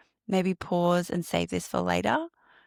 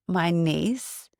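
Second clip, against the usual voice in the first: ordinary talk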